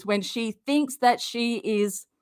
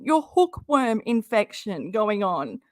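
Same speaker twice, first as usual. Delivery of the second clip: ordinary delivery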